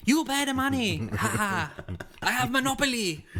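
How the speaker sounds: hammed-up Chinese-accented outback mechanic, mocking. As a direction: lord it over the stranded driver in a cartoon Chinese accent. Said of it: "like a Chinese automobile, like, mechanic guy".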